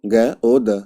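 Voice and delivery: ग ओ द sounded letter by letter; ग ओ द, one letter at a time; spelled as written. Shown as a demonstration. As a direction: read ग ओ द out slowly, each letter apart. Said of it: "ये क्या है?".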